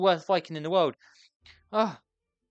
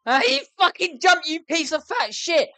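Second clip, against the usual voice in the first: exasperated yell; 8 semitones higher, 7 dB louder than usual. Real, not acted. Character finally jumps.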